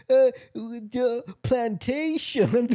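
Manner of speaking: put-on slow drawn-out Southern accent; imitating a Southern accent badly. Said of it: "doing that Southern accent".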